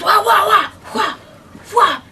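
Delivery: high loud kiai shouts; karate battle cries. Acted. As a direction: yell each hit like a ninja. Fight sound effects, martial arts.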